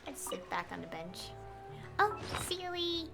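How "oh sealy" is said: normal talking voice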